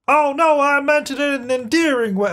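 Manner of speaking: high, hammed-up character voice, drawing out the words; nectar guy voice. Nectar guy teasing the pregnant lady, high hammed-up voice. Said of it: "I don't know what his voice was either".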